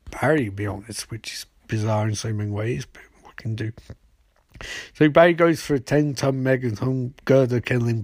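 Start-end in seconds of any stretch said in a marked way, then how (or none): 0.5-3.7 whispered under the breath; conspiratorial whisper; whispered aside. Real, not acted. Aside about odd chemistry.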